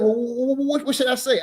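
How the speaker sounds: high, stammering imitation; flustered teacher. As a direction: stumble over the words like a cornered man. Teacher stammering, asking what to say.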